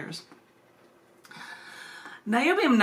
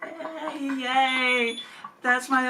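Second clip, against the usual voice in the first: giddy yay; 5 semitones higher, much slower than usual. Real, not acted.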